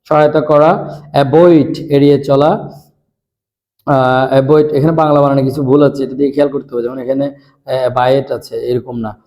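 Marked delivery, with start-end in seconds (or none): none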